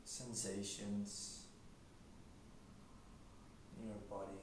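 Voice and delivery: quiet, unhurried meditation voice; guided meditation. Acted. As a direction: gently guide them into stillness, leaving long silences.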